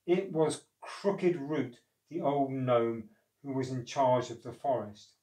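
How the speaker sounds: plain reading voice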